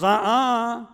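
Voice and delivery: put-on high, loud childish "Uh-uh"; indignant little boy. Johnny denies it to his brother, high and indignant. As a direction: whine a kid's flat denial.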